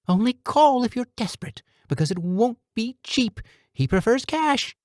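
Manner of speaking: high pinched urgent warning voice; nasally voice. A narrator's reading. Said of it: "nasally voice that belonged to a much smaller man".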